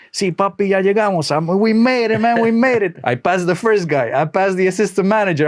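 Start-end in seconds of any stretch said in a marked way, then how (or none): 0.1-3.0 triumphant. Retold or imitated. She retells her inner triumph on reaching the manager, excited and high. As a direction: celebrate the win, pitched up. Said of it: "He's like".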